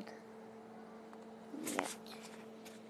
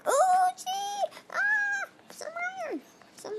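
very high excited squeal; 3 dB louder than usual. Real, not acted. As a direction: squeal high at a lucky find. Excited find in the game.

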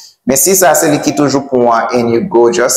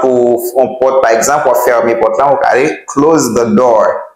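ordinary talk

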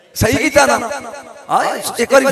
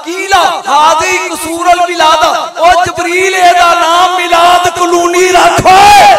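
high, loud shouted sermon climax; fiery preacher shouting God's command; full-force preaching; 7 semitones higher, 8 dB louder, slower than usual. Acted. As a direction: roar it out to the crowd, slowing at the end.

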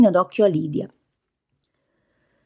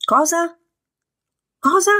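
sharp, much higher startled questions; harsh cry; 11 semitones higher, 3 dB louder than usual. Acted. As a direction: snap the words out high and harsh. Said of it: "La voce di Lydia era aspra".

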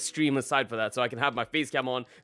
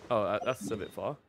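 ordinary talk, nothing marked

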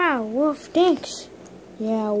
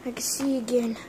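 soft whispered relief; hushed pretend relief; relieved whisper; 2 semitones lower, 5 dB quieter than usual. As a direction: breathe it out quietly. Figure recovers.

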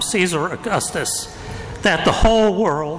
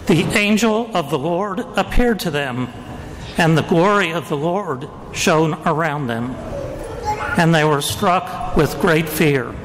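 Gospel reading. Acted. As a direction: proclaim it like a liturgical reading.

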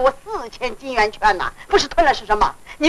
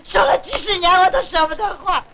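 tearful wailing. Acted. Mourning gold swallowed by the landlord, tearful wailing. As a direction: wail and sob over the loss. Woman laments her lost gold.

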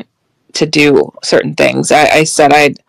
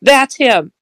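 pitched-up alarm, reenacted; retold scream; 8 semitones higher, slower than usual. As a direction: imitate a frightened sister crying out. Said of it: "and she screams".

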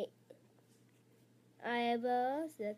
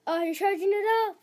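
high, loud, rapid play-by-play cry; mock excitement; 7 semitones higher, 7 dB louder, much faster than usual. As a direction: hype up a pretend power-up, fast and loud. Play-acting a Kamehameha charge-up.

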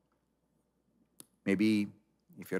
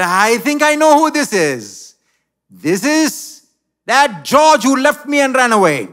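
much higher and louder, triumphant recognition; jilted woman exposing George; loud accusation; 12 semitones higher, 12 dB louder than usual. Acted. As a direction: call him out for all to hear.

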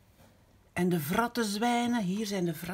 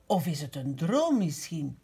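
plain reading voice

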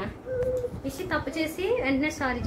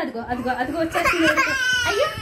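laughing tease; 8 semitones higher, faster than usual. Real, not acted. Mother teasing child about crying.

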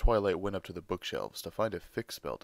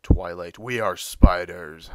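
loud, insistent shout as Big Mac; exasperated shout; 13 dB louder, slower than usual. Acted. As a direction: bellow it at Twilight, slowly. Big Mac spider pressing Twilight.

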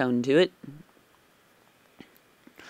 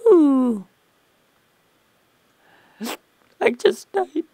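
high surprised ooh; 11 semitones higher, 5 dB louder, slower than usual. Real, not acted. Reacting to dying.

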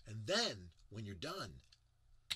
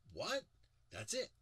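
mock disbelief; 9 semitones higher, 4 dB louder than usual. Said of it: "And you're like".

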